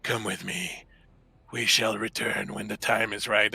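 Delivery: lightly voiced demon. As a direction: lightly play the departing demon. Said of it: "and goes".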